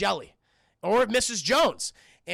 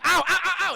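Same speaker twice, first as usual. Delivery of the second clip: high, loud repeated ows; playful yelping with a laugh; mock yelps of pain; 10 semitones higher, 8 dB louder, much faster than usual. Jelly is taken outside, high laughing yelps.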